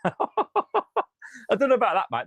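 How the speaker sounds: slow, laughing reply. Real, not acted. Fan laughing off a viewer's wild comparison, amused. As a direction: laugh it off, doubtful.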